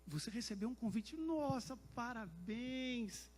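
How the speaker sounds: delighted congratulations. Retold or imitated. Rector congratulating him on the invitation, delighted. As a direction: gush it, stretching the praise out slowly.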